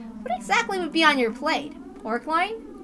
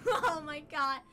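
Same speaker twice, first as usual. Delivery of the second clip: plain reading voice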